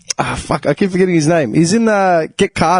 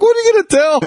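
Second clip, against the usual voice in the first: very high-pitched acted retort; mock protest; faster than usual. Retelling the driveway story to his friend, high mock protest. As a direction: pitch it way up, teasing. Reenacted reaction to handbrake stop.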